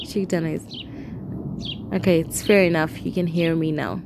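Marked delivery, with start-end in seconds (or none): 0.1-1.9 whispered repeat; softly whispered; whispering. Real, not acted.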